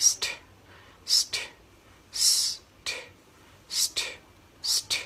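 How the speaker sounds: "st" as a voiceless hiss closing on T; isolated ST drill. Shown as a demonstration. Tutor has students practise the ending, isolated ST drill. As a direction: repeat "st" alone, no vowel, with gaps. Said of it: "ให้ฝึกจากการที่เราทำเสียงนี้ให้คล่องก่อน".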